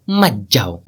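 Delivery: put-on low elderly counter-order; grandfather's voice. Grandfather contradicts father, low old man's voice. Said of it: "दादाजी की आवाज".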